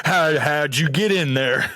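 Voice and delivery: put-on Southern accent, slowed right down. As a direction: talk to the sugar in a mock Southern accent. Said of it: "a deep Southern accent".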